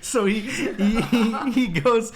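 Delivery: high, slow words breaking up with laughter; laughing while talking. Real, not acted. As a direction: try to resume through helpless giggles. Cracking up after his voice is mocked.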